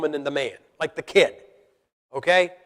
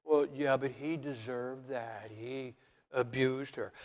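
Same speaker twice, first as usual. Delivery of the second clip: mock excusing voice; 4 semitones lower, 12 dB quieter, slower than usual. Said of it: "like a woman".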